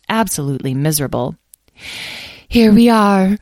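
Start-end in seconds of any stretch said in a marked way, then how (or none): 2.5-3.4 high, loud, sorrowful fairy voice; sad fairy. A narrator's reading. Mud-covered fairy answers the guild leader, sadly. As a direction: lament as a miserable little fairy. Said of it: "said one sadly".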